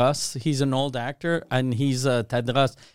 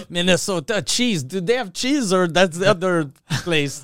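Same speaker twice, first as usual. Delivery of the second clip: higher, mock French Canadian English; thick Quebec accent; 4 semitones higher than usual. Bogus Minnesotan mixing up states, in a thick Quebec accent. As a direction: play the confused guest in a put-on Quebec accent. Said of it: "with a thick Quebec accent".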